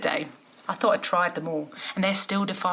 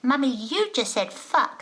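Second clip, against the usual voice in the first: put-on four-year-old tattling; 8 semitones higher than usual. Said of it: "my four-year-old appears from nowhere and says".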